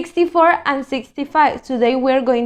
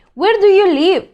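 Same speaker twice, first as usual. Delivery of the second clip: asking tone; 6 semitones higher, 5 dB louder than usual. Shown as a demonstration. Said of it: "This is another question".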